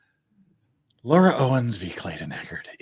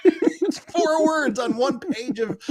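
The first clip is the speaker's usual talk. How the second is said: laughing; 13 semitones higher, slower than usual. Real, not acted. Laughing at the ruling.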